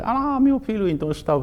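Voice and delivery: imitando uma mãe aflita, voz bem mais aguda; mãe chorando. Retold or imitated. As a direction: lamento agudo de mãe preocupada. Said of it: "essa senhora chorando, fala". (mãe conta ao taoísta, chorando de preocupação)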